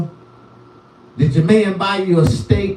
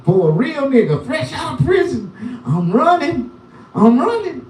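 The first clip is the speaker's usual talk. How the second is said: higher, shouted hype; excited shouting; 9 semitones higher than usual. Acted. Fresh-out-of-prison comic shouting to viewers in excitement. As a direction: yell it like you just got out.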